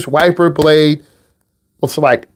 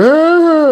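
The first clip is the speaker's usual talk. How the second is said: high mock wail of dismay; a hammed-up pained cry; 11 semitones higher than usual. Student crying over tickets.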